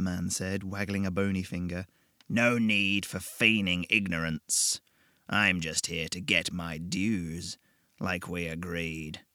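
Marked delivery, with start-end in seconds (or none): 2.3-9.2 slow, lower, menacing purr; old devil collecting a debt, smug; the devil, sly and patient. Acted. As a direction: savour every word like a bargain won.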